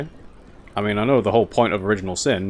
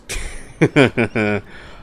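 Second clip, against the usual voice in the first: light giggling laugh; amused chuckle. Real, not acted. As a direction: chuckle to yourself at what you read. Amused reaction while reading the game.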